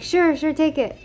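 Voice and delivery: mock-generous shopkeeper; imitated ready agreement. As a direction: imitate a shopkeeper happily waving it through. Retells generous shopkeeper agreeing readily.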